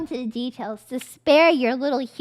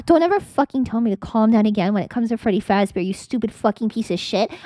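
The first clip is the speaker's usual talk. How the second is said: mock fury; 6 semitones lower than usual. Mock rage at chat.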